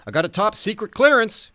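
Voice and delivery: high, loud, proud cowboy voice; imitating the Ledge. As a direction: brag it like the eccentric cowboy. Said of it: "he revealed".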